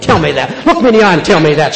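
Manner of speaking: forceful challenge. Real, not acted. Challenging the congregation.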